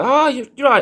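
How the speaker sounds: sudden high delighted gasp; high, excited recognition. Retold or imitated. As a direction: squeal of delighted surprise. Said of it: "I was like".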